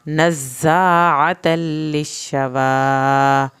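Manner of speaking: slow, loud, drawn-out tajweed chant; Quran recitation. Shown as a demonstration. Teacher recites aloud for students, slow loud chant. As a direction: chant each word slowly for the class to follow. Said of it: "دال کا قلقلہ ہوگا".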